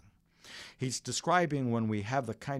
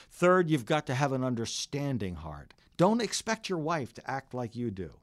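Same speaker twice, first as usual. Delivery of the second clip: ordinary delivery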